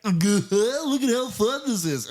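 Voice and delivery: high, slow, sarcastic fake cheer; overdone mock glee. Host mocking the movie's forced Vegas fun, high slow sarcastic cheer. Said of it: "it's so overdone".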